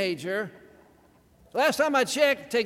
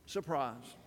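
dropped low and much softer, falling; low dry aside; 8 semitones lower, 10 dB quieter than usual. Acted. Preacher to congregation, a low soft knowing aside. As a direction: murmur it like a wink. Refrain after trailing "kingdom.

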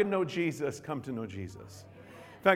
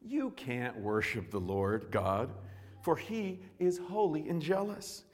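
grave warning; slower than usual. Acted. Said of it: "Joshua replied".

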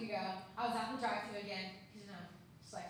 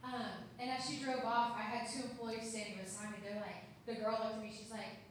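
normal talking voice

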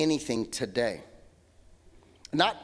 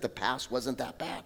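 lower, softer "the past wasn't that bad." dropped low; quiet aside; 7 semitones lower, 9 dB quieter than usual. Shown as a demonstration. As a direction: murmur "the past wasn't that bad." almost to yourself. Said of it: "the enemy's great work is to remind us that".

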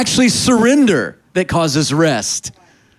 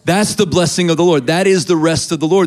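ordinary talk

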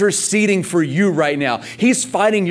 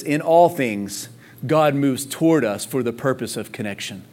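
"in all things" drawn out slowly, then lower and softer; slow, lower restatement; 6 semitones lower, 4 dB quieter than usual. Shown as a demonstration. Preacher rephrasing the verse, slow and low. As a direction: linger on "all", let the voice sink. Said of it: "I wanna say it like this".

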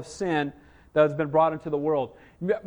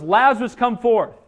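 louder preacher's voicing of a summons; calling out command; 3 semitones higher, 6 dB louder than usual. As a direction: call him out with authority. Jesus calls Lazarus from the grave.

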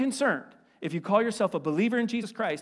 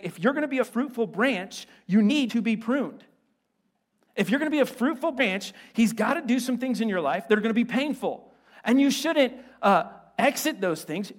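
ordinary talk, nothing marked

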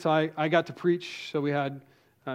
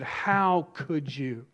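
retold angry accusation; much slower than usual. Women storm down aisle at preacher.

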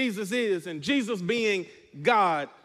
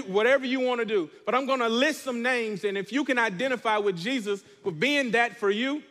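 ordinary talk

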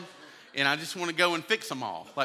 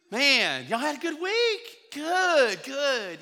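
much higher, sing-song friendly greeting; chirpy small talk; 8 semitones higher, much slower than usual. Retold or imitated. Friends chatting at church after a car fight, chirpy and high. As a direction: put on your best Sunday smile.

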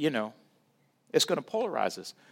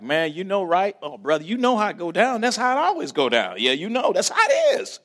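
higher, louder knowing banter with a laugh; a hint of easy talk among brothers; friends' banter; 5 semitones higher, 5 dB louder than usual. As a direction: lightly voice a brother who gets it. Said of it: "It was like".

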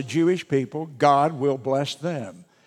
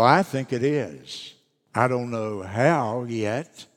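ordinary talk, nothing marked

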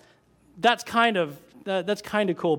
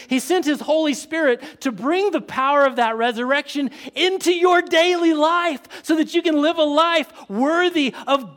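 climbing higher and louder to a shouted glory; preaching at full force; 6 semitones higher, 4 dB louder than usual. Acted. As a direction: drive it home to the peak.

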